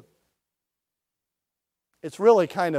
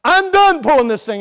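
fed-up ox; 6 semitones higher, 7 dB louder than usual. Retold or imitated. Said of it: "that animal's going".

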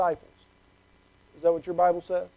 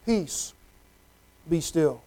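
soft, low, hushed command; quiet the storm gently; gentle hushed authority; calm command; 4 semitones lower, 7 dB quieter, slower than usual. Acted. Jesus calming the storm.

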